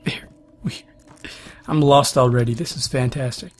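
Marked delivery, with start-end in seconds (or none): 0.1-0.8 soft puzzled whisper. Real, not acted. Lost player whispering to himself. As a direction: murmur it under your breath.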